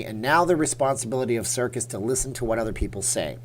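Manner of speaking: ordinary delivery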